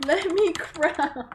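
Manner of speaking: slow, high plea cracking into a laugh; begging the game through laughter; laughing frustration; exasperated laugh. Real, not acted. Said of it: "I'm gonna scream".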